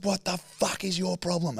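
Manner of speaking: high, slow mock yell; strained, high-pitched retold shout; imitated angry driver. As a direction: act out a driver's yell, held back in volume. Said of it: "me and him screaming at each other".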